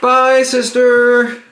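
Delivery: calling goodbye. Acted. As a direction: call out slowly after someone leaving. Calling goodbye after departing sister.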